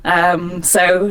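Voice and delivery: ordinary delivery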